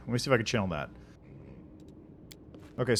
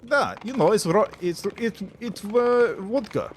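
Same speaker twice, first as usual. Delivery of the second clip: halting Russian accent, pitched up; 5 semitones higher than usual. Shown as a demonstration. Streamer improvising about vodka, Russian accent. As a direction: stumble along in a put-on Russian accent. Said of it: "Russian would be like".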